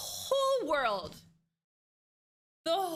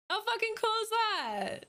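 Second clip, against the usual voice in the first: amazed; 8 semitones higher than usual. Real, not acted. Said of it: "repeated exclamation".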